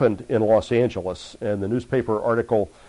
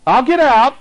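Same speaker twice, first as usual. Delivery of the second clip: high, loud anguished demon cry; a beaten spirit wailing surrender; loud high wail of a dying demon; 12 semitones higher, 9 dB louder than usual. Retold or imitated. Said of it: "he cried out".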